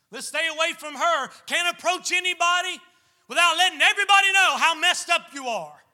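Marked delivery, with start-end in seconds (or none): none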